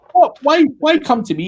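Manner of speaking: high-pitched, incredulous protest; hammed-up squeak of disbelief at being asked; mock bafflement. Son to father asking for computer repairs, mock baffled.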